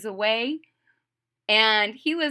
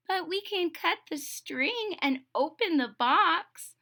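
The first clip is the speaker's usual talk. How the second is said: higher toad, pleased with the loophole; Toad's voice; 7 semitones higher than usual. Acted. Toad finding a way around Frog's string, higher voice. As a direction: point out the trick slyly.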